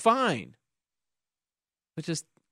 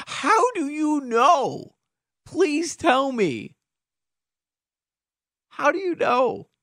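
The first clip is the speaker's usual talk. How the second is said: high, slow, disbelieving; incredulous high voice; 11 semitones higher, much slower than usual. Real, not acted. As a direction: demand to know, drawn out. Host mocks texter in disbelief.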